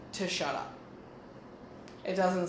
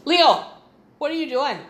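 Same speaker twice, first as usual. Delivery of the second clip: raised, high-pitched call to the dog; calling out sharply; 7 semitones higher, 11 dB louder than usual. Real, not acted. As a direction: break off and call the dog to account. Scolds the dog mid-recording.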